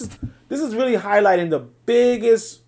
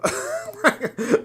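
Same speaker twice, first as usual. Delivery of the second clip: incredulous laugh. Real, not acted. Reacting to the voice chat.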